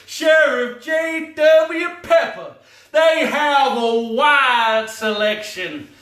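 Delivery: loud hammed-up yell; mock shout. Ranting about Sheriff Pepper, loud mock shout.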